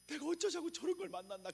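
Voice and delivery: high mock-exasperated wail at one's partner; fed-up spouse. Preacher imitating a spouse regretting the marriage, high exasperated wail. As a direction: whine it like a spouse at the end of their rope.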